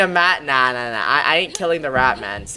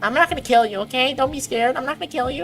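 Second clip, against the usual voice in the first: soothing the rat; 10 semitones higher than usual. Acted. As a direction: gently coo to a frightened pet. Reassuring the rat tenderly.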